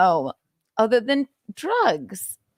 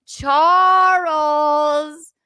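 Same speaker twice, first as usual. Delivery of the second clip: higher, louder, long-held name; louder chiding call; 4 semitones higher, 5 dB louder than usual. Pretend. Host catches the trickster caller, louder drawn-out chiding. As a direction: sing out the name, stretched further.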